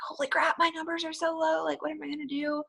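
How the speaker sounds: high, panicky inner voice; panicked self quote. Retold or imitated. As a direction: replay the moment of panic. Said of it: "I was like".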